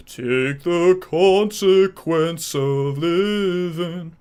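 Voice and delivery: very slow, drawn-out singer voice; TMS singer impression. Streamer mimics how the singer talks, very slowly. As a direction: stretch every word like a dreamy singer. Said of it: "he talks like this".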